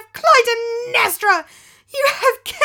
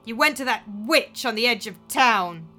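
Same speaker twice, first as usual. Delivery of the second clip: accusing; 3 semitones higher, 6 dB louder than usual. Acted. Daughter accuses stepmother before crowd.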